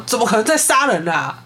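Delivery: half-voiced outburst of protest; retold shouting. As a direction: imitate an indignant cry without much volume. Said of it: "又继续喊说".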